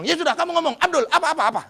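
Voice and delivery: loud, high impatient demand; impatient father. Retold or imitated. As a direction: snap at the kid to talk. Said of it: "Akhirnya dia bilang". (ayah menyuruh bicara dengan tidak sabar)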